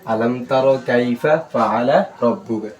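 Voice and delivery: slow, measured recitation with long vowels; Quran recitation. Shown as a demonstration. Teacher models the verse for students, slow recitation. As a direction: recite slowly, drawing out the long syllables.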